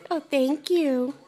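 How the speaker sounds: ordinary talk, nothing marked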